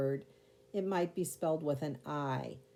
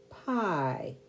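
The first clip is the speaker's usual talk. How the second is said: ordinary talk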